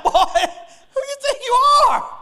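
loud, high-pitched comic shout; hammed-up shouted challenge to a cocky son; mock fatherly outrage. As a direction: yell it fast and high, playing the affronted dad.

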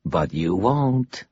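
slow, dry mockery from a dusty voice; mocking. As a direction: drag it out to taunt him. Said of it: "mockery in her tone".

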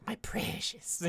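high whispered creature voice; Gollum-like gloating. Acted. Thief alone with stolen button, high creepy whisper. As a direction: gloat over a treasure in a strained whisper.

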